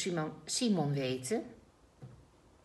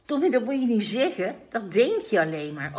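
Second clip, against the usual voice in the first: put-on fast, loud scolding child; indignant child; 5 semitones higher, 7 dB louder than usual. A narrator's reading. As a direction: tell him off, offended.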